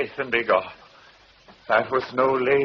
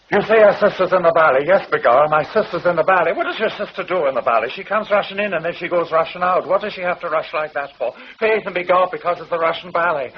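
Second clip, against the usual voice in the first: loud stage Irish; 8 semitones higher, 4 dB louder, faster than usual. Acted. Performer plays both parts, louder.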